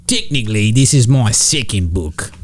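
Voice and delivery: Nanette voice. Pretend. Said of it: "keep doing this with Nanette voice".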